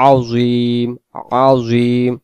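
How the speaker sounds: আযীম with a clear z sound; Arabic z sound. Shown as a demonstration. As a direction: say আযীম slowly, z voiced. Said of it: "জ অক্ষর দিয়ে যুক্ত".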